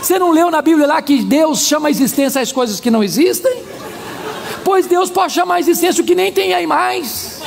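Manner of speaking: high-pitched, animated preacher's rebuttal; eager hopefulness. Retold or imitated. Pastor offering hope to a despairing woman, eager.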